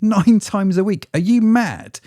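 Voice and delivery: high, loud disbelief at the number; nine times a week, really?; incredulous. Real, not acted. Incredulous at posting advice.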